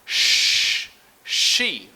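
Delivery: Sh hissed loud, then she; sh sound. Shown as a demonstration. Teacher runs through all the sounds, loud hissed sh. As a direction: hiss the Sh hard into she. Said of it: "go through all the sounds".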